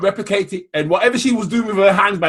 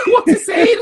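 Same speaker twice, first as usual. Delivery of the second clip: higher, laughing shout of disbelief; 11 semitones higher, 5 dB louder, slower than usual. Real, not acted. Mocking a friend's tomato sauce claim with a laughing shout. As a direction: cry it out through laughter, slowly.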